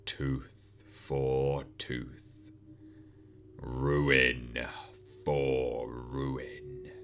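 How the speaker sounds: measured, slow cold threat from a demon; Pinhead. Acted. Pinhead speaking.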